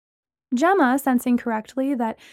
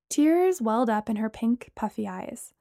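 ordinary delivery

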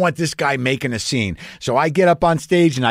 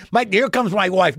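ordinary delivery

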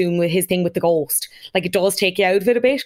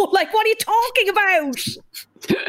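high, drawn-out incredulous outburst; exasperated disbelief; 13 semitones higher, slower than usual. Acted. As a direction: scoff in stretched-out, high-pitched disbelief. Mocking incredulity at retold excuse.

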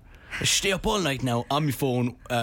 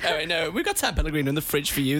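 ordinary talk, nothing marked

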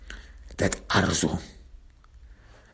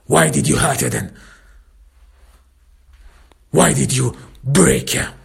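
higher-pitched angry yell; 4 semitones higher, 4 dB louder than usual. Acted. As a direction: demand an answer, raised voice. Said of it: "I yell at him".

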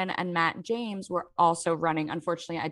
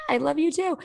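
sweet reply; 8 semitones higher than usual. Retold or imitated. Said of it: "And she's like".

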